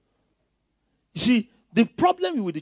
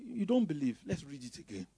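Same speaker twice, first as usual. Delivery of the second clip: ordinary talk, nothing marked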